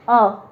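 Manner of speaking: "अ" alone, a short uh sound; isolated vowel sound. Shown as a demonstration. Teacher showing the first sound of umbrella, demonstrating a lone "अ". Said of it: "इसको हम पढ़ रहे हैं".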